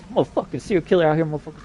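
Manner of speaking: ordinary delivery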